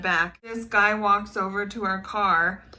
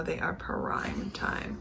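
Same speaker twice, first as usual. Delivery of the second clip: whispered aside; 4 semitones lower, 9 dB quieter, much slower than usual. Real, not acted. Whispered aside about coconuts.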